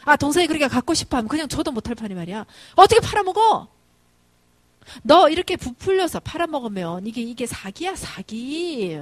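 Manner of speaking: loud, high, indignant telling-off; scolding yell. Retold or imitated. Mother scolding son for selling to his little brother, scolding yell. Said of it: "이제 소리를 지르죠".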